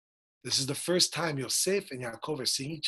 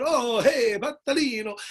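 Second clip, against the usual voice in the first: mock Italian greeting, higher pitched; 6 semitones higher than usual. Father and son greeting like Italians, mock Italian accent. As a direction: ham up an Italian hello. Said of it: "they're Italian also".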